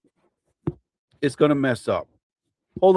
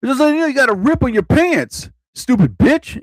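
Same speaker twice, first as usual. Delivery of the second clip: mock angry guy; 9 semitones higher than usual. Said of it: "The first guy I remember said".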